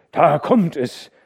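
laut, hoch und gedehnt ausgerufen; rufend. A narrator's reading. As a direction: mit voller Stimme warnend rufen. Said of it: "rief Hauke". (gedehnter lauter Ruf)

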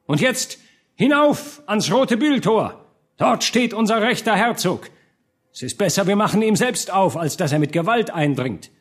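high, emphatic orator's voice; rally the crowd, building to a final cry; rallying speech. A narrator's reading. Hartmann leads burghers to open the gate, rallying speech.

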